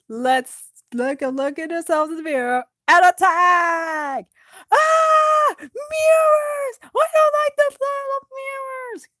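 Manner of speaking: high, loud panicked cry; panicked shouting. Acted. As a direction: scream in panic at the mirrors. Panicked elf shouting about mirrors.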